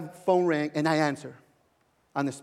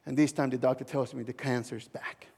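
hushed whisper; 6 semitones lower, 7 dB quieter than usual. Retold or imitated. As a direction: whisper the bad news, barely voiced. Whispered bad news retold.

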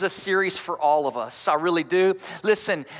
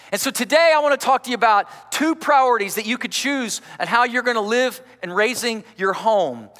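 ordinary delivery

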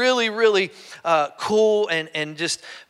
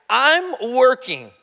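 higher, very slow and weighty; emphatic divine reassurance; 6 semitones higher, 3 dB louder, much slower than usual. Acted. God insisting to Habakkuk, slowly and firmly. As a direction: lean hard on each word.

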